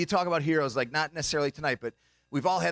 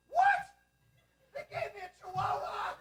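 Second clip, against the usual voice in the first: very high, soft squeal of disbelief; mock shock; 5 dB quieter than usual. Blind man learning his dog is tiny, high shocked squeal. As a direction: squeak it out far above your own voice.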